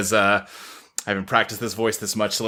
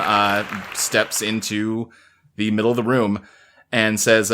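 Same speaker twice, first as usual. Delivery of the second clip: ordinary talk, nothing marked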